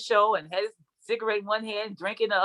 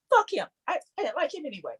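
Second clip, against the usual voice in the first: dismissive; 8 semitones higher than usual. Retold or imitated. Dismissive young self quote.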